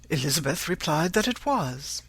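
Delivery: ordinary delivery, nothing marked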